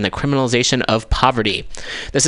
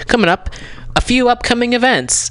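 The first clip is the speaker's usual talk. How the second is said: much higher-pitched radio announcement; voice going up; 13 semitones higher than usual. Real, not acted. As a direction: let your voice shoot up high while announcing. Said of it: "my voice goes up a few octaves".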